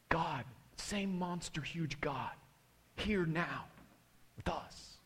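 slow, hushed, with long pauses; real quiet prayer. Retold or imitated. Pastor coming down from his shouting, quiet imitation. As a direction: bring the imitated prayer down to a near whisper. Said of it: "real quietly, he, he comes down".

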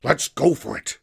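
raring to go; eager. A narrator's reading. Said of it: "said Ash eagerly".

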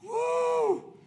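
high-pitched woo; excited whoop. Real, not acted. Preacher catching himself after an outburst, high whoop. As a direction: let off steam with a whoop.

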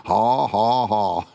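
imitated laugh, each ha drawn out; Brother Hagin's forced laugh. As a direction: laugh on purpose, mimicking a preacher. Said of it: "I can still hear his voice".

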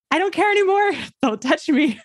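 high retold cry of giving up; pained refusal. Mother re-enacts refusing IV in pain, high protest. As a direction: refuse in a high voice, then ease down. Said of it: "it hurt so bad".

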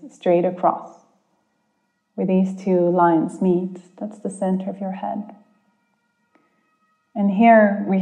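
calm guide easing listeners inward. Acted. Leads a guided meditation.

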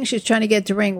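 normal talking voice

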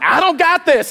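high-pitched protesting comeback; mock exasperation. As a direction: act out an overwhelmed dad protesting. Dad's exasperated comeback to parents.

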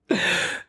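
quick high laugh; a little laugh at the joke; short laugh. Real, not acted.

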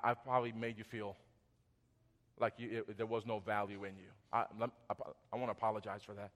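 low, soft, sincere apology; tender apology. Acted. Husband owns cutting spouse off, softly repentant. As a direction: apologize gently, voice dropped low and quiet.

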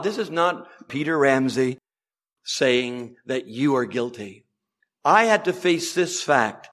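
normal talking voice

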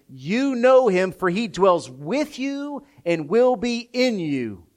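loud, high, rising scripture proclamation; preaching at full volume; full-force preaching. Acted. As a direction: thunder the promise from the pulpit.

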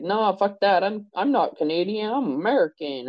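dismissive rejection. Retold or imitated. Imagined person rejecting the Canadian label, mildly scornful. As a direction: toss the label away with scorn. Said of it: "and be like".

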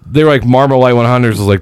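ordinary delivery

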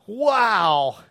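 big gasp, then a drawn-out wow; mock amazement. Pastor reacting to a couple's choice to wait, hammed-up shock. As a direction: play up the astonishment.

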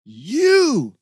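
roared. Acted. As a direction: bellow it at him in rage. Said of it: "she roared".

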